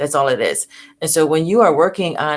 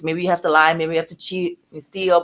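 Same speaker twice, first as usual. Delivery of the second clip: normal talking voice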